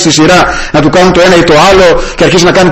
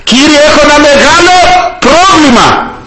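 high-pitched, drawn-out cry for help; crying out to God; 11 semitones higher, slower than usual. Acted. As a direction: call out to the Lord, much higher than usual. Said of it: "Κράβγασε".

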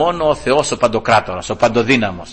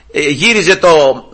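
ordinary talk, nothing marked

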